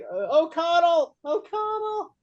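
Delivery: high, slow mock plea of O'Connell; hammed-up frightened call for help; imitating Daniels. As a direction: whine the name up high like a scared sidekick. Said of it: "Daniels, where he's like".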